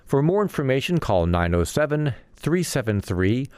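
measured announcer reading of event listings; radio calendar read. Real, not acted. Host reads events calendar.

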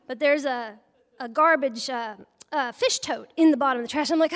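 ordinary talk

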